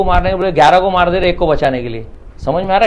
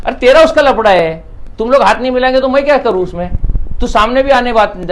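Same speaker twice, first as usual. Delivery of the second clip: higher, louder mock protest; retold exasperated retort; 6 semitones higher, 4 dB louder than usual. Mediator throwing the feud back at the quarrelling man, retold exasperated retort. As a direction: protest it back, raised and pushed.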